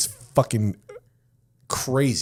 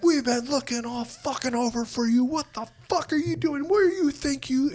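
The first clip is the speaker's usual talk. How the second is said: much higher mock yell; screaming sergeants; 3 dB quieter than usual. Sergeants finding the new guy, high mock yell. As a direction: yell it like furious drill sergeants. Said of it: "they just start screaming at me".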